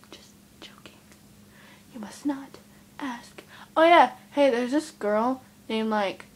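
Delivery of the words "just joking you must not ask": unvoiced teasing whisper. Real, not acted. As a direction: whisper a joking warning to the viewers.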